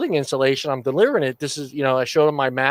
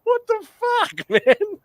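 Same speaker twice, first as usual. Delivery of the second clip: disbelieving what the fuck; slower than usual. Retold or imitated. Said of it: "So I'm sitting there going".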